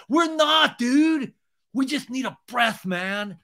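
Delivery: high, whiny exasperated bro; worked-up mock complaint; exasperated protest. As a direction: push back loudly, then plead. Acted exasperated Tom arguing.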